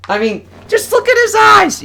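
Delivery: delighted shout. Real, not acted. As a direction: yell it out laughing at the panel. Overjoyed at funny panel.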